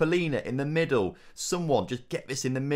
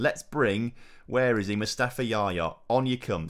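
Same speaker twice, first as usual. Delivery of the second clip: ordinary talk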